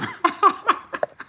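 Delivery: breathy high-pitched laugh bursting out; a real delighted giggle, way up high; high airy laugh. Real, not acted. Reacting to a viewer's comment with a high breathy laugh.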